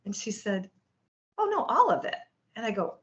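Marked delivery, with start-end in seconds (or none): 1.4-2.2 high, breezy matter-of-fact answer; casual assurance. Retold or imitated. Nutritionist assuring new patient everything will reverse, casually certain. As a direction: toss it off as entirely obvious.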